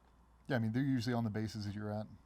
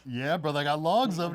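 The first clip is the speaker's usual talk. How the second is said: higher and louder, proud show-off; excited brag; 6 semitones higher, 10 dB louder than usual. Retold or imitated. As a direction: boast it like the best news ever. Bragging about his Copenhagen stash.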